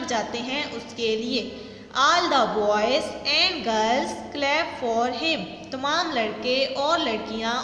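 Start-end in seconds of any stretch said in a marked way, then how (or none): 2.0-5.5 very slow, every word spaced out for the class; slow dictation read. Shown as a demonstration. Teacher reading a sentence to students, very slow and deliberate. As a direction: read it slowly, word by word, so students can follow. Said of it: "teacher rereads the sentence".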